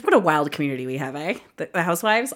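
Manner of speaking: ordinary talk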